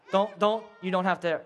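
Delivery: ordinary delivery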